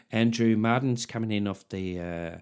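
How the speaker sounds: hushed cricket commentary voice; a hint of TV cricket commentary; cricket commentator. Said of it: "sounds like a county cricket medium pacer".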